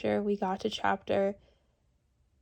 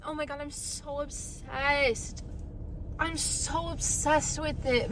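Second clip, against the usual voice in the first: slow, higher-pitched gushing; gushing delight; 4 semitones higher, 5 dB quieter, slower than usual. Real, not acted. Vlogger gushing over freshly done hair, drawn out and delighted. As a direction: linger on the words, thrilled with it.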